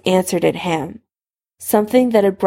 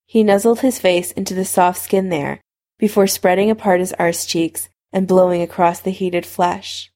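ordinary delivery, nothing marked

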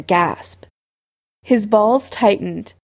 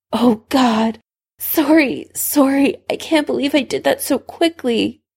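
mildly flustered, higher-pitched character voice; a touch of dismay, pitched up; embarrassed apology; 6 semitones higher than usual. A narrator's reading. Severus apologises to Harry, mildly flustered.

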